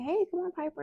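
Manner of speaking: high coaxing call to a dog. Retold or imitated. Owner calling her runaway dog, high and coaxing. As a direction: lure her back, sing-song and high.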